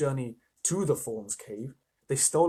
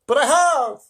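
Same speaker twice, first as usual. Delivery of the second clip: anguished admission; 12 semitones higher, 12 dB louder than usual. A narrator's reading.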